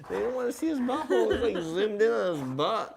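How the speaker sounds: high, loud laughing words; real laughter at the dog's butt on camera. Real, not acted.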